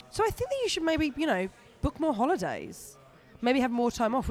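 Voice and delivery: hint of a gentle advising agent; agent's voice. Agent's advice retold in voice.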